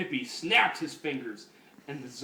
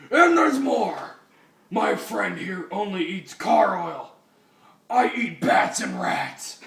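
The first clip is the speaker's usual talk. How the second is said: gloating zombie; 5 semitones higher, 7 dB louder than usual. Acted. Zombie boasting to hippies.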